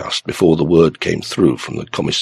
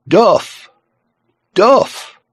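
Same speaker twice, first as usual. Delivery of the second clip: high, loud repeated name; shouting; 13 semitones higher, 5 dB louder than usual. A narrator's reading. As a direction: bellow it down the phone. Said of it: "realized that he'd been shouting".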